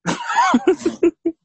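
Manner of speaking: high-pitched burst of laughter. Real, not acted. Laughing with a listener over hard demands, high real laugh.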